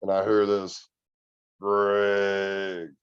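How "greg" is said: the voice from the woods, imitated with an unfamiliar timbre; a lightly mimicked call of his name out of the dark; mimicked creature voice. Retelling a night at camp, imitating the thing that called his name. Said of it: "It sounded like it said my name".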